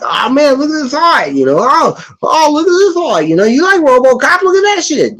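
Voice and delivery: very high, hyped-up pitch selling the art; excited gushing. Retold or imitated. Big brother hyping comic art to his brother, squealing high. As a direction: gush over the art to hook him. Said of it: "I'll be like".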